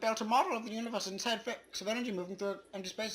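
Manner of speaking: high, fast, squeaky voice; high sped-up voice. Acted. Reading the End Poem in a squeaky voice. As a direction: rattle it off high and quick.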